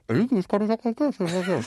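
muffled, high voice through fingers; hand over mouth. Shown as a demonstration. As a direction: talk into your cupped hand. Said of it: "put it over your mouth like this".

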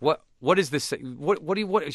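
high, loud, baffled outburst; mock-exasperated mother reading a paper; mother shocked by her son's essay; mom getting angry. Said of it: "get angry. And she'd be like".

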